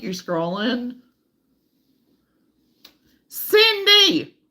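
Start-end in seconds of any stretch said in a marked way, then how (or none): 3.3-4.4 high, loud delighted call of a name; excited call. Real, not acted. Greeting a viewer by name with a loud delighted call. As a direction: call her name out with joy.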